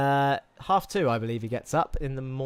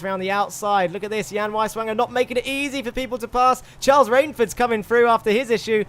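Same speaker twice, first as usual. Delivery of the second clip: loud, raised-pitch motorsport play-by-play; live race commentary; 7 semitones higher, 9 dB louder than usual. Real, not acted. Commentator calling a race start to stream viewers, excited play-by-play. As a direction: call the race live with rising urgency.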